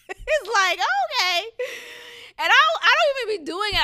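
high loud laughing disbelief; laughing exasperation. Real, not acted.

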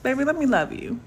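ordinary talk, nothing marked

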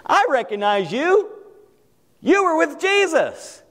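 acted accuser, pitch climbing; second accuser. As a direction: call out someone you recognize. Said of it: "somebody else come up to him and said".

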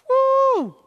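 high shouted woo; joyful whoop. Real, not acted. Preacher whooping at the church in excitement. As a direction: let out a whoop of joy.

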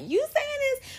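very high, squeaky disbelief; shrill-high teasing "you saying this?"; mock disbelief. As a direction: act out a friend who is shocked. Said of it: "the people that know me are like".

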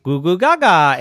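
silly babble. Acted. Yvette saying silly things to herself, silly babble. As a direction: burst out with silly baby talk. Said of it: "we could say silly things".

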